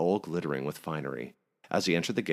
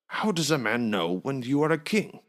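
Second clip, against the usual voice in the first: higher, louder pleading; pleading innkeeper; 9 semitones higher, 4 dB louder than usual. A narrator's reading. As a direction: beg the king's pardon. Androsh pleading to king.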